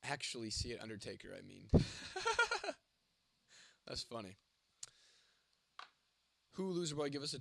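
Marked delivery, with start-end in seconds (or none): 1.7-2.7 high-pitched giggling laugh; real, high chuckling fit; amused laugh. Real, not acted. Amused by a chat message.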